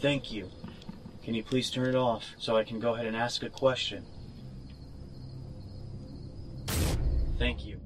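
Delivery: hushed, grateful to the spirit; soft thanks. Real, not acted. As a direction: murmur thanks to the ghost. Speaking softly to spirit.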